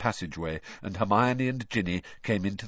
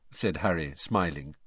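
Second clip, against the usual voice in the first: ordinary delivery, nothing marked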